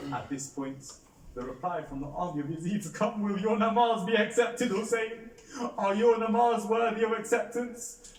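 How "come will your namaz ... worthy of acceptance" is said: high, loud jeering challenge; shouted scornful taunt; mocking taunt. A narrator's reading. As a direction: sneer it at Hussein. Enemy army mocking the prayer.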